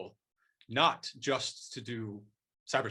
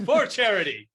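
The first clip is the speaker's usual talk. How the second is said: big, high, playful shout; mock battle cry; 9 semitones higher, 11 dB louder than usual. Guest echoing the host's joke slogan, mock-heroic shout. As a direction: echo the slogan as a loud hammed-up cry. Echoes the jokey rallying cry.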